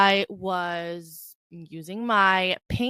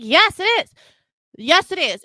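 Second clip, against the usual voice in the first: high, loud insistence; worked-up comeback; indignant retort; 9 semitones higher, 6 dB louder than usual. Retold or imitated. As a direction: snap back at the teacher. Acted retort to the teacher.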